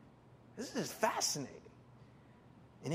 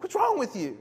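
exasperated captain; 10 semitones higher, 5 dB louder than usual. Retold or imitated. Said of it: "He's like".

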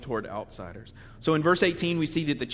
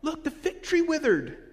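mock amazed Peter; 12 semitones higher, 3 dB louder than usual. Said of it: "And you're like".

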